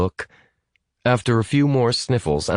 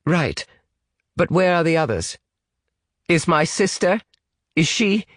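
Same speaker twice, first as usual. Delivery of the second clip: urgent British shout; 10 semitones higher than usual. A narrator's reading. British boy demands news of his sister, urgent. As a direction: build in pitch to a mild cry. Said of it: "Ian shouted this last part".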